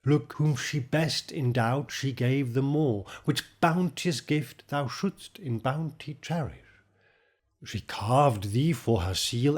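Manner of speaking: formal poetry reading; poem recital. Acted. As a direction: read it as verse, line by line. Recites the sonnet opening.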